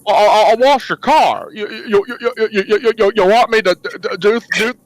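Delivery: heavily stuttering eager offer in character; Jimmy Stewart. Acted. Jimmy offering anything for a McRib, stammering. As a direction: stammer on nearly every word, hammed up. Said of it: "Now he sounded like Forrest Gump".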